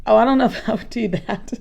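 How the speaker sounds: ordinary delivery, nothing marked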